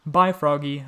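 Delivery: a loud, giggly teasing goodbye; giggling. A narrator's reading. Boy teasing his frog friend, giggling loudly. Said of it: "I said, giggling".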